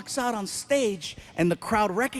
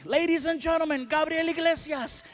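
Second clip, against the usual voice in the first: higher MC announcing; higher emcee announcement to a crowd; announcer; 6 semitones higher than usual. Retold or imitated.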